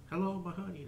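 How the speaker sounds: high, laughing mock seduction; flirty elf greeting. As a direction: lay on the charm, half laughing.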